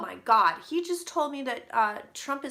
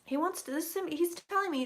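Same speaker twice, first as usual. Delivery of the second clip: ordinary talk, nothing marked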